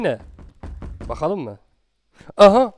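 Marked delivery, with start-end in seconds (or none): 2.4-2.7 loud sudden gasp-shout; startled cry. Real, not acted. Player caught off guard, startled shout. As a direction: blurt it out in shock. Jumpscare in horror game.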